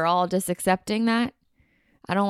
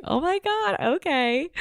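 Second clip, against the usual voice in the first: much higher, thrilled "Oh my God, okay"; excited squeal; 12 semitones higher than usual. Retold or imitated. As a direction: gasp with delight at the surprise. Reacting to surprise.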